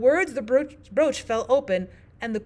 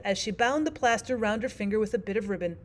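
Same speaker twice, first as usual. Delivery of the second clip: plain reading voice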